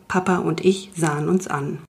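usual speaking voice

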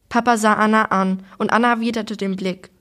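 ordinary delivery, nothing marked

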